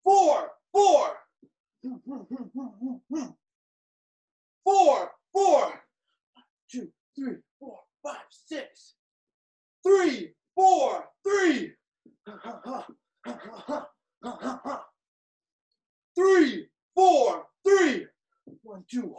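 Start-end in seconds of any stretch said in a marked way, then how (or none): 0.0-5.6 shouted combo calls, high and loud; boxing coach calling combos. Real, not acted. 9.8-18.1 boxing coach calling combos. Real, not acted. Boxing class, coach shouting combos.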